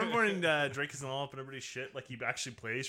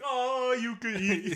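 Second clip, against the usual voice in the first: higher, slow, louder mock greeting; drawn-out jokey compliment; playful imitation of the movie; fat boys voice; 7 semitones higher, 4 dB louder, much slower than usual. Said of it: "they got the fat boys like".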